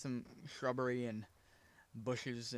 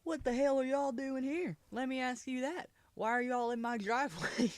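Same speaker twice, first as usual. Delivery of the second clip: much higher, indignant friend voice; 10 semitones higher than usual. Retold or imitated. Friend confronting the firemen, high and indignant. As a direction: demand it like he owns the place.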